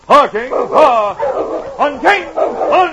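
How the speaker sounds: loud high musher's call; calling out to dogs. Acted. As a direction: shout commands to the sled dogs. Musher calling to dog team.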